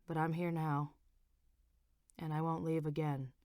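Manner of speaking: low, soft husband voice; husband instructing the nurse, low and soft; Frank's voice. A narrator's reading.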